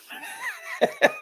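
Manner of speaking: high wheezing laugh; real laughing fit. Real, not acted. Commentator cracking up at his handball court joke, real high laughter. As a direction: crack up helplessly.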